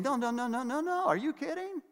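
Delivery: high, mocking "no, no, no" of a child; mocking voice of a defiant child. Mock child refusing parents.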